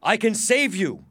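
high, loud, urgent promise; urgent offer. Acted. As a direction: cut through the panic. Prisoner offers rescue amid panic.

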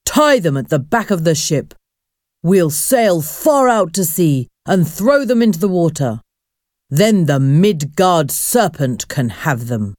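usual speaking voice

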